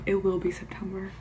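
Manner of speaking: whispered aside. Real, not acted. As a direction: breathe it as a secret. Aside to viewers.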